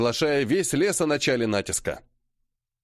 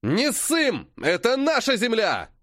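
rally the troops against the charge; rallying shout; 7 semitones higher, slower than usual. A narrator's reading. Rallying the defenders.

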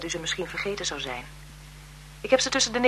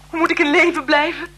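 higher and louder, pleading; desperate cry; 8 semitones higher, 8 dB louder than usual. Acted. Grieving sister pleading with the marquise, desperate cry. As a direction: cry out in despair.

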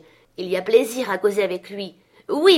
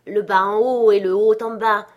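plain reading voice

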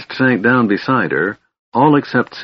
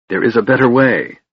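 plain reading voice

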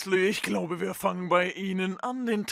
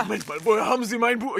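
startled; 4 semitones higher, 6 dB louder, faster than usual. Acted. Snape startled about book.

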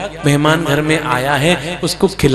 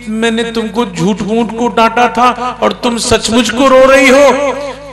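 a baffled husband who can't believe she's really crying; rising disbelief, stretched out; surprised husband; 6 semitones higher than usual. Acted.

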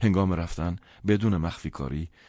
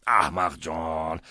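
slow, drawn-out angry scolding; angry father; 4 dB louder, slower than usual. A narrator's reading. Father scolds his son slowly, with restrained anger. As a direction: drag out the insult with mild anger. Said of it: "ولی عصبانی بود".

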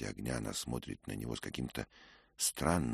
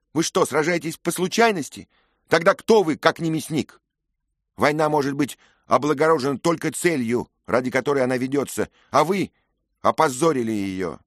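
higher, louder scolding; scornful young lady; 14 semitones higher, 14 dB louder than usual. A narrator's reading. Lady scolding Dick for fighting without reason, scornful. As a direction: tear into the boy with contempt.

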